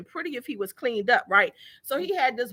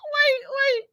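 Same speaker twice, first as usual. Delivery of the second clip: high, squeaky laugh through the words; can barely get it out for laughing. Real, not acted. Retelling the door fight, cracking up.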